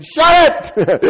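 loud, high-pitched yell; reflexive panicked shout. Retold or imitated. Skydiver to cameraman as the door opens, reflexive shout. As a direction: blurt it out in comic alarm. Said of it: "reflexively I said".

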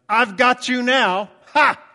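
loud, high triumphant gotcha. Shown as a demonstration. Preacher demoing a triumphant ha, loud. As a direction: crow over catching someone. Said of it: "Ha is the sort of thing that I say when".

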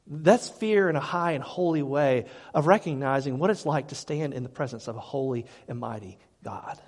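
usual speaking voice

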